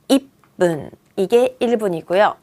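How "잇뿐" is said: "잇분" split by a stop, ending in hard PPUN; deliberate model of "잇분" with doubled P; strong pronunciation. Shown as a demonstration. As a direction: cut off "잇", then push out "분" as tense "뿐". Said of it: "세게 읽어요".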